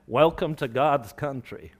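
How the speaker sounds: slow, lightly attempted American accent; poor American accent. Preacher imitating greeting heard in the USA, poor American accent. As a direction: have a half-hearted go at an American accent. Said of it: "That was a poor accent".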